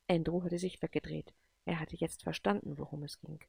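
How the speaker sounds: ordinary delivery, nothing marked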